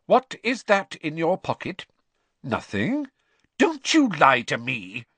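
stern scolding; stern mother. A narrator's reading. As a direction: tell him off, firm and slow.

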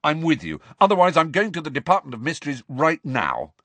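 high, forceful ultimatum; heated, impatient insistence; aggressive. A narrator's reading. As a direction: lay down terms combatively. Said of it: "Harry said aggressively".

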